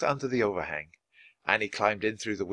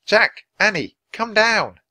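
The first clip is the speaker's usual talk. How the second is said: calling up to them, higher and louder; 10 semitones higher, 9 dB louder, slower than usual. A narrator's reading. Teddy and Kathleen calling up from below. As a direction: call them down from the tree house. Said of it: "called from below".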